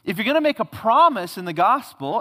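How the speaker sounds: ordinary delivery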